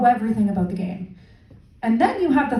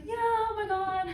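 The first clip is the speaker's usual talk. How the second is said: high, softer gushing chat; excited fan's small talk, pitched up and soft; chatty sports fan; 7 semitones higher, 9 dB quieter than usual. Acted. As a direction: gush to a friend in a light, raised voice. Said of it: "having a lovely conversation with his friend".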